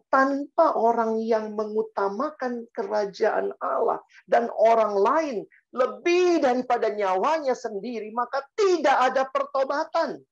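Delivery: high, insistent preacher's delivery building to a climax; impassioned preaching. Acted. Preacher warning the congregation about repentance, impassioned preaching. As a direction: proclaim it with full conviction.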